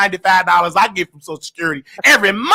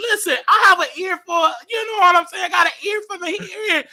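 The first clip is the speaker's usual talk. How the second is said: high excited coaxing; 9 semitones higher than usual. Real, not acted. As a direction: coax her, voice pitched up. Host coaxing caller to sing.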